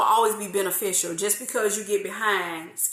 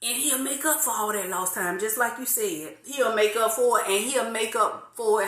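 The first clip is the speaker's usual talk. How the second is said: normal talking voice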